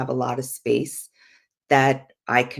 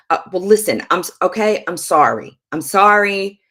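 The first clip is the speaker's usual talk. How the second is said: loud, higher, exasperated non-apology; grudging "sorry" pushed out impatiently; fake apology; 4 semitones higher, 7 dB louder than usual. Shown as a demonstration. Showing spouses how not to apologize, insincere and irritated. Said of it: "don't be like".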